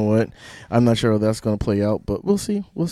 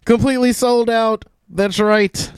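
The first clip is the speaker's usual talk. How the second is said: hammed-up announcer; 10 semitones higher than usual. Hammed-up promo boast.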